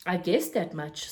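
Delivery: plain reading voice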